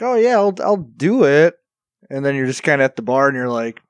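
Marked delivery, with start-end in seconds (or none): 0.0-1.5 higher-pitched mock willingness; put-on obliging voice; mock eager agreement. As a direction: agree a little too readily, acting it out. Acting out agreeing to drive.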